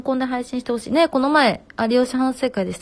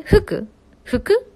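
服 said with a rising, higher second mora; questioned intonation; 10 semitones higher, 5 dB louder than usual. Shown as a demonstration. Streamer testing the intonation of 服 for a commenter, rising. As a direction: try 服 as a rising question, pitch climbing. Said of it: "服のイントネーション".

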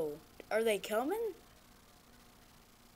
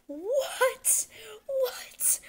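high breathless what; stunned disbelief; 11 semitones higher than usual. Real, not acted. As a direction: gasp it out in disbelief. Disbelief after scene.

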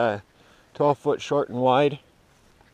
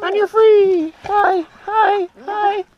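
pet voice; 11 dB louder, much slower than usual. Real, not acted. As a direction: squeal it at the dog like a happy owner. Letting the dog out, greeting her.

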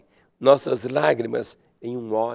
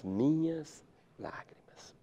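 whisper; 12 dB quieter, slower than usual. Acted. Whispered end of psalm.

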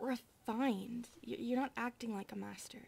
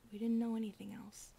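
ordinary talk

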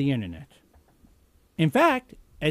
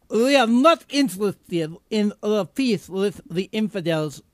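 slow, heavy mock Middle Eastern accent; 3 semitones higher, 3 dB louder than usual. Radio host mocking North Korea's spokesman in a Middle Eastern accent. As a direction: ham up a Middle Eastern accent, slowly and deliberately. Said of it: "that's the only accent I can do".

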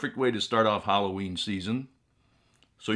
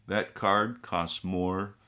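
ordinary talk

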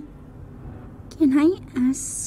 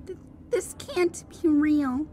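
disbelief; 2 semitones higher, 7 dB quieter than usual. Acted. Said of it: "Sadie said in disbelief".

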